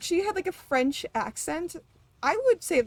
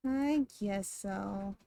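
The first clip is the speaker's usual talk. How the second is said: lower, softer and slowed right down; slow quiet echo; 5 semitones lower, 5 dB quieter, much slower than usual. Shown as a demonstration. Streamer echoing her own words in a slow quiet mutter. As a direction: mumble it back slowly to yourself. Repeats it to herself.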